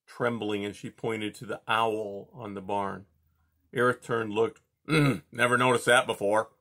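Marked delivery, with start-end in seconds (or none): 4.9-6.5 gruff, offhand grunt. A narrator's reading. Porcupine unimpressed by the owl, grunting. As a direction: shrug it off with a grunt. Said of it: "he grunted".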